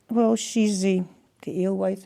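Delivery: normal talking voice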